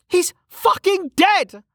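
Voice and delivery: very high, slow, drawn-out outburst; screamed outburst. A narrator's reading. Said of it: "Velika screamed".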